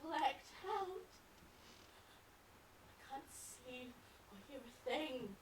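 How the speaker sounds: hushed resignation with long pauses; desolate and drained. Acted. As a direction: let the words fade out, emptied of life. Vision gone, feels dead and empty.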